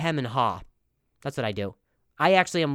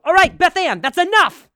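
high, loud scolding yell; mock stern shout; 12 dB louder than usual. Comic yelling at old ladies knitting behind him, loud and high. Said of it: "I go".

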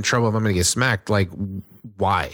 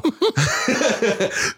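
short high laugh; 13 semitones higher than usual. Real, not acted. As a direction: burst of laughter. Laugh mid-sentence.

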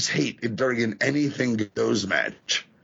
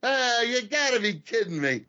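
fan complaining; 10 semitones higher than usual. Retold or imitated. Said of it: "a guy in the crowd go".

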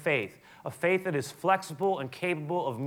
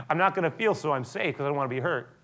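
usual speaking voice

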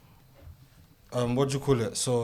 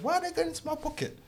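confused; 3 dB quieter, faster than usual. Retold or imitated. Confused while being robbed.

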